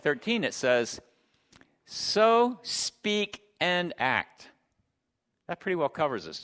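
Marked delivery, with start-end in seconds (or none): 1.9-4.5 very slow, spaced-out words, pitched up; deliberate scripture reading. Real, not acted. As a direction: weigh every word of the verse. Preacher reads key verse deliberately.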